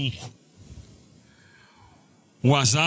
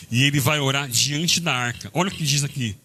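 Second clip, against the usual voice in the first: ordinary talk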